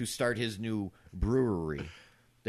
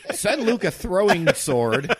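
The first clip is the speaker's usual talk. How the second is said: loud, high, drawn-out joke line; gleeful mock battle cry; playful outburst; 9 semitones higher, 10 dB louder, slower than usual. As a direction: belt it out to top the joke. Loud joke riffing on Zelda.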